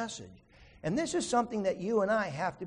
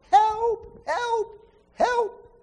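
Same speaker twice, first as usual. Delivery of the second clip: high, loud cries for help; screaming for help; 9 dB louder, much slower than usual. Retold or imitated. Man dangling off a cliff, screaming for help. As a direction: yell up from the cliff edge. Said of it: "he starts screaming for help".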